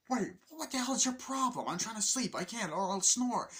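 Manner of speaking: high-pitched defensive retort; indignant protest. Retold or imitated. Roommate defends his bedtime noises, high indignant protest.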